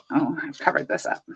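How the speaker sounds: ordinary delivery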